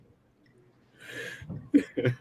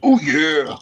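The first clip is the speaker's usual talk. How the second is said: much higher, louder wrestler roar; Macho Man yell; 12 semitones higher, 7 dB louder than usual. Pretend. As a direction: belt out the catchphrase. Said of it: "That's Macho Man".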